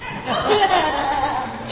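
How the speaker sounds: quiet breathy laugh; soft laugh. Real, not acted. Preacher laughing after biting back a word. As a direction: laugh it off gently.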